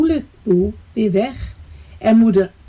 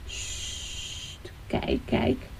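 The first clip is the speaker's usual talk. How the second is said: soft shush into a voiceless whisper; hushed whisper; 13 dB quieter, much slower than usual. A narrator's reading. Teacher points Rika to the parents, hushed whisper. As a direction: whisper it so the birds stay put.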